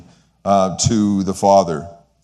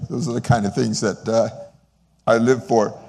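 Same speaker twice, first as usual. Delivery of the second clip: ordinary talk, nothing marked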